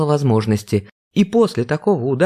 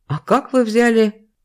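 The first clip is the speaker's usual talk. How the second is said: high, slow amazed question; surprised; 9 semitones higher, slower than usual. Acted. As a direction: ask it in disbelief. Vera amazed by hair.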